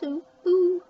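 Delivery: high, loud owl hooting ooh ooh; playful high owl hoot. Pretend. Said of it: "owl's eyes".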